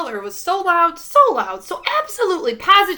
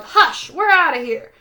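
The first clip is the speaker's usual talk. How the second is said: a bit higher and louder, a mild yell; yelled hush; 5 semitones higher, 6 dB louder than usual. A narrator's reading. Catfish telling Holler off, mild yell. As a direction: snap it back at the noisy boy. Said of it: "The catfish yelled".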